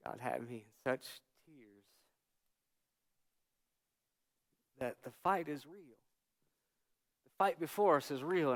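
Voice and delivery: very soft, halting testimony; moved, hushed. Real, not acted. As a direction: let the volume fall away, with long pauses. Recalling tears at end of service.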